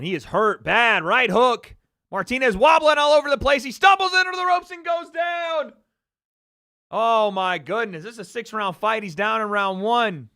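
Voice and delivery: loud, high live fight call; wrestling commentator. Real, not acted. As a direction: call every punch at full volume. Live boxing play-by-play.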